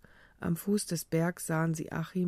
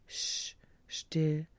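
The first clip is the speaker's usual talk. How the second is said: gentle hushing. A narrator's reading. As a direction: hush someone tenderly. Hushing her loved one tenderly. (sanftes, leises Beschwichtigen)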